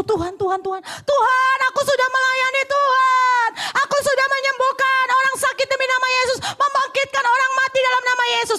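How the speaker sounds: pleading shout, high and loud; loud high pleading cry to the Lord; crying out to God. Retold or imitated. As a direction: cry out desperately Tuhan Tuhan. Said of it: "orang yang berseru".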